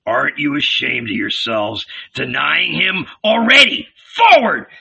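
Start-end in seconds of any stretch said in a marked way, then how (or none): 4.2-4.7 high, loud order; sudden commanding yell; shouted command. A narrator's reading. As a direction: shout it like a rallying leader. Judas orders disciples.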